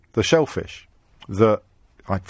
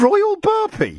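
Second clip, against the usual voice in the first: much higher, slow, stretched incredulous cry; utter disbelief; much slower than usual. Real, not acted. Host repeats the caller's odd name, amazed and drawn out. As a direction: savour the absurd name in amazement.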